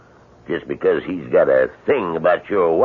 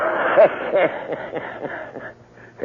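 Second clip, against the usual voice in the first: incredulous laugh; 5 semitones higher than usual. Acted. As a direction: burst out laughing at him. Neighbor mocks offer to buy.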